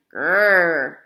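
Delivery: loud, rumbling growl; angry dog growling loudly. A narrator's reading. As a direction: growl to scare them off. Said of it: "growled Scratch".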